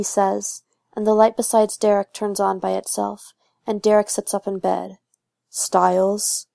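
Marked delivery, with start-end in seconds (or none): 5.5-6.5 warning tone. A narrator's reading. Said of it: "in this warning tone".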